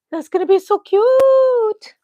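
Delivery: high, loud and slow, gushing; gushing excitement. Real, not acted. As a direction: coo over something adorable. Gasps at finished doll outfit.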